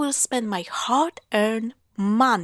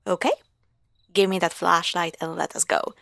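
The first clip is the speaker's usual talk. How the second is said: normal talking voice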